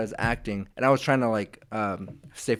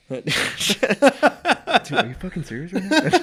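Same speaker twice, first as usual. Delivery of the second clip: higher, quick question through laughter; laughing disbelief; 8 semitones higher than usual. Real, not acted. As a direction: crack up while protesting. Ribbed by co-host about girlfriend.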